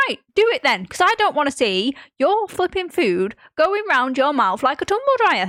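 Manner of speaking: high, loud comic telling-off; mock exasperated rant. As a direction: lay into dad in play-acted outrage. Retold rant at dad.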